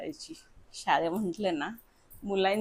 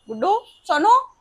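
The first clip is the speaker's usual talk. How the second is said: high, loud, drawn-out summons; calling names; 10 semitones higher, 11 dB louder than usual. Real, not acted. Mother summoning Guddu and Sonu to teach the poem, calling out. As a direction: call them over, stretching the names. Calls the girls over.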